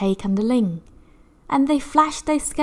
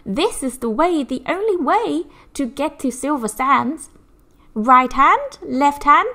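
high, louder coaching voice; eager acrobat; 8 semitones higher, 6 dB louder than usual. Acted. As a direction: cheer her on like a bouncy trainer. Acrobat teaching the mermaid.

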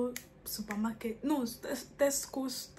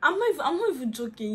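laughing; 4 semitones higher, 6 dB louder, faster than usual. Real, not acted.

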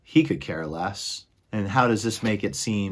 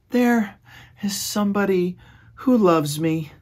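lovestruck little mouse; 10 semitones higher than usual. Acted. Despereaux asking his brother about love, very high and dreamy. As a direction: float the question like he's in a daze.

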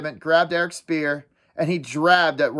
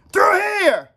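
high urgent yell to the others; boy's shout; 5 dB louder than usual. Acted. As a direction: shout it across the thicket. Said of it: "filled with shouting again from the boys".

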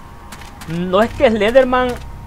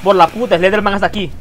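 ordinary talk

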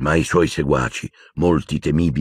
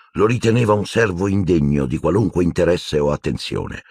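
ordinary delivery, nothing marked

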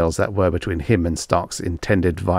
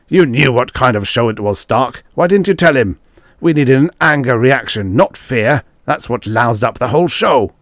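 loud, raised, insistent blame; angry dressing-down; 5 semitones higher, 5 dB louder than usual. A narrator's reading. Producer rounding on Stark over the spoiled show, angrily. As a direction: lay into him for ruining it.

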